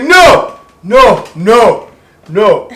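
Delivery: high, loud disbelieving no; retelling the dad's stunned refusal, loud and high; dad's shocked no. Retold or imitated. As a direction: cry out no, no in shock. Dad's reaction retold again.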